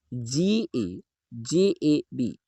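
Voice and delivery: very slow, letter by letter; slow spelling out. Shown as a demonstration. Teacher spelling गजब slowly for the class. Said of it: "गजब का spelling हो जाएगा".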